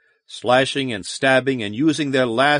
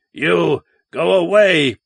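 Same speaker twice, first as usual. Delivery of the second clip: loud pained groan from a huge beast; 5 semitones higher, 5 dB louder, slower than usual. A narrator's reading. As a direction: push it out through the pain, loud. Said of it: "groaned Wocha".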